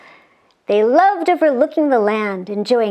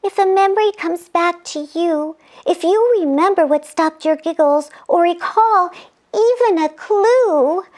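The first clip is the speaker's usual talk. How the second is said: put-on lightly raised girlish voice; angel voice; 6 semitones higher than usual. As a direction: explain it with concern. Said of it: "continued Angel Scarlet".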